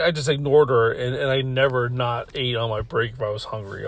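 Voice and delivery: ordinary delivery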